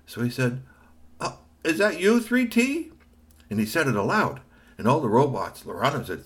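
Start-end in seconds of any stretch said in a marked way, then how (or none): 1.2-2.9 timid high robot voice. A narrator's reading.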